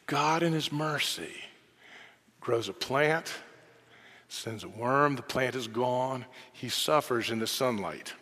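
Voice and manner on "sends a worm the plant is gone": normal talking voice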